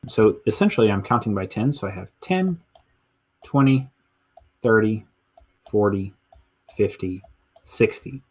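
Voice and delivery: ordinary talk, nothing marked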